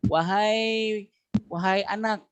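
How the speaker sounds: teacher demonstrating a call. Shown as a demonstration. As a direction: call out to a child. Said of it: "kalau gitu, wahai".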